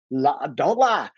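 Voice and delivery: ordinary talk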